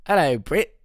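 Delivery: bad Kiwi accent, tried out in short bursts; attempted New Zealand accent. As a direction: have a go at sounding New Zealand. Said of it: "only thing I can do for a New Zealand accent".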